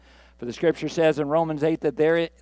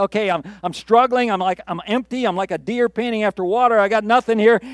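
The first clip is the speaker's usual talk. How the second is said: raised, louder voice of someone running on empty; acted struggling; 5 semitones higher, 6 dB louder than usual. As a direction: plead your case as the worn-out psalmist.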